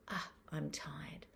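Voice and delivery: slow, hushed sigh of tiredness; quiet self-noticing. Shown as a demonstration. Parent quietly names own tiredness, hushed and slow. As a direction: breathe it out softly to yourself. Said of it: "we're noticing what's happening for us".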